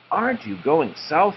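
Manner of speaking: a blue jay asking the cardinals, high and light. Acted. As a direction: ask it up high like a small bird. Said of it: "he asked".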